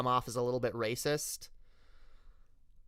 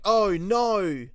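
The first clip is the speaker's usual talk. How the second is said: loud, higher Australian accent; 6 semitones higher, 6 dB louder than usual. Shown as a demonstration. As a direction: slip into broad Australian. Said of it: "That's, that's Australian".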